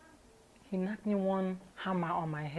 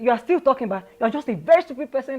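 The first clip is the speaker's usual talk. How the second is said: louder, higher, heated retort; angry retort; 5 semitones higher, 10 dB louder than usual. Retold or imitated. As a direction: snap back in rising anger. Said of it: "that one was even paining me the more".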